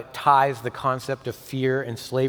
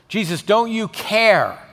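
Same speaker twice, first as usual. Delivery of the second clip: much higher, louder, slow desperate cry; panicked shout; 11 semitones higher, 9 dB louder, slower than usual. Retold or imitated. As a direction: shout it over the storm to wake Jesus. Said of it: "woke him up shouting".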